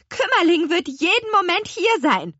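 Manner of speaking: high, loud little-witch shout; urgent, high-pitched call. A narrator's reading. Little apple witch hurries the apple men, calling out high. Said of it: "rief die kleine Apfelhexe".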